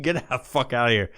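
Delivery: usual speaking voice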